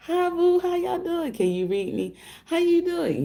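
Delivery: sing-song squeaky hello to viewers; high mock greeting. Greeting her live viewers in a high put-on voice.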